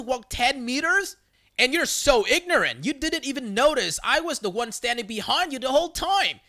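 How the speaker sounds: high, indignant telling-off; acted exasperated outburst; losing restraint. A narrator's reading. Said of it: "I can't contain myself anymore".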